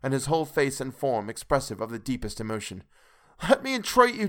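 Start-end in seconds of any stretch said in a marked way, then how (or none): 3.5-4.3 high, loud, begging through tears; desperate tearful pleading. A narrator's reading. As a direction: plead for your life and soul, voice straining high. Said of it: "poured forth a torrent of entreaty".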